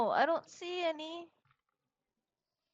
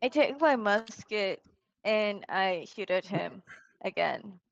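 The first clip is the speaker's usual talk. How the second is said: slow, flat, lower deadpan; 5 semitones lower than usual. Real, not acted. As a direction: drag it out deadpan. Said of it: "So descriptive".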